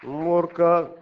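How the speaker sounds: Мурка with a rolled Russian r and Odessa lilt; Мурка, Odessa-style pronunciation; Odessa accent. Shown as a demonstration. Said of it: "with an Odessa accent".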